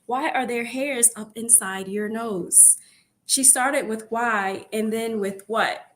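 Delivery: ordinary delivery, nothing marked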